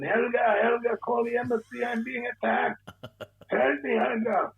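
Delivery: put-on very high, slow Swedish accent, fading to a whisper; a panicked fake Swede calling for Helga; hammed-up Swedish accent, pleading, ending in a whisper. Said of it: "I put on my best Swedish accent".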